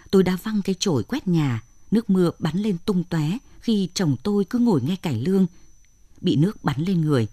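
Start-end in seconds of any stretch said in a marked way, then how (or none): none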